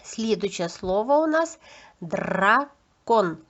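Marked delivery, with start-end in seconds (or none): 2.0-3.4 "дракон" in two separate syllables with a trilled R; teacher slowly segmenting "дракон"; sound-by-sound demo. Shown as a demonstration. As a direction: say "дра", pause, "кон" for "дракон". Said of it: "зробимо звуковий аналіз слів".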